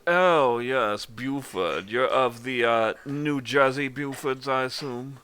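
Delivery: theatrical high-society gentleman; haughty party host. Acted. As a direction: greet them grandly, stretching the words. Game master voices a haughty greeter.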